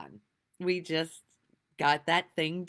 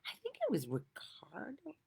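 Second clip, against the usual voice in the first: whispered aside; 5 semitones higher, 8 dB quieter than usual. Real, not acted. Aside while recalling a name.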